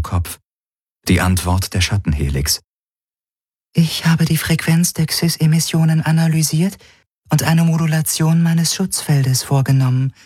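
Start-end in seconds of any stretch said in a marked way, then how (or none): 3.7-10.1 beautiful, high, even voice; an artificial mind reporting in high, level tones; Helix. Acted. The Helix whispers its answer in his head, beautiful even voice. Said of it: "sagte ihre schöne Stimme".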